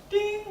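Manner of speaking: high chimed "Ding"; lift chime. Retold or imitated. Ventriloquist faking the lift chime to the woman. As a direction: sing out a quick ding. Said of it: "He goes".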